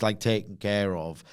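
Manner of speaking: normal talking voice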